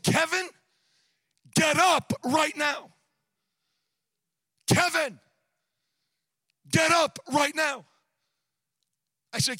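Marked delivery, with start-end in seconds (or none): 0.0-7.8 loud, higher, commanding divine voice; voice of God. Retold or imitated. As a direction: call out God's order, each phrase set apart by long pauses. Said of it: "the voice said".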